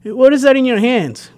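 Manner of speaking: high-pitched questioning wife; uncle's wife. Retold or imitated. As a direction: imitate a wife querying her husband, pitch way up. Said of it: "his wife would be like".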